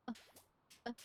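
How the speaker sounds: ordinary talk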